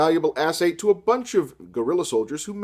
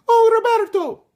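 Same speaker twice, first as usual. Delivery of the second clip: much higher, louder swooning falsetto; Maria falsetto; 8 dB louder than usual. Acted. Maria crying out to Robert in a high falsetto. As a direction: wail his name like a soap heroine.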